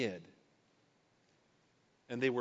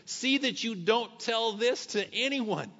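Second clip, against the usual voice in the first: slow, higher and firm, each word weighed; strong warning; 7 semitones higher, 4 dB louder, slower than usual. Retold or imitated. Jesus warning the healed leper, slow and firm. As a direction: warn him gravely, spacing the words. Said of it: "with a strong warning".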